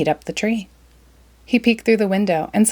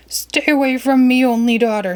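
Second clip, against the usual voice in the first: yelling; 6 semitones higher, 3 dB louder than usual. Acted. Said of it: "leaped up and yelled".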